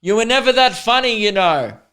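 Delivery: retelling a teacher's parting dig; imitating the teacher. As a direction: toss off the insult as the old teacher. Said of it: "he just turned around and went".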